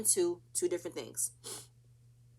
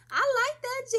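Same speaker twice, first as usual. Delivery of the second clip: high, louder hype voice; mock cheer; 7 dB louder than usual. Recapper mimics the group cheering G's joke in a high hype voice. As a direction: hype it up like fist-pumping friends.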